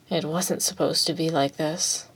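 soft, emptied-out voice; drained and dead. A narrator's reading. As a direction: let it fall quiet and lifeless. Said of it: "his voice sounding drained and dead".

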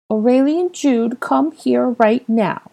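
high, slow, mock-fierce order; child mimicking stern mother. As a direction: scold like a toddler playing mum. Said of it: "barked in fierce mother's tone".

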